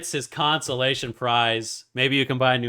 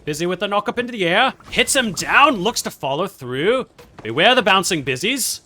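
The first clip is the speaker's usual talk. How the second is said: high, loud mock British sportscast; British accent; 9 semitones higher, 10 dB louder than usual. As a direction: commentate excitedly in a put-on British accent. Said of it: "cast in British accent".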